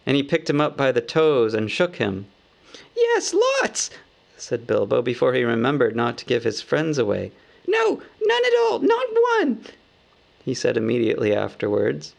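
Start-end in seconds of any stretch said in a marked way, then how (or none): 3.0-3.9 very high squeaky hobbit voice, blurted. Acted. Bilbo blurts answer. 7.7-9.6 high hobbit voice. Acted. Bilbo corrects himself.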